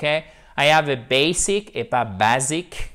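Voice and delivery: "basic" said the English way, then the French way. Shown as a demonstration. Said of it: "Accent tonique sur la première syllabe". (opposer basic anglais et basic français)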